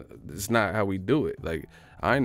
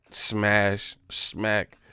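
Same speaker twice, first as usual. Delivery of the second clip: ordinary talk, nothing marked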